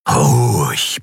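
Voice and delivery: low, steadying order to oneself; self-command. A narrator's reading. Keru orders his racing heart to settle, low self-command. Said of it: "befahl er sich".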